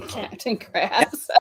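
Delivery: ordinary talk, nothing marked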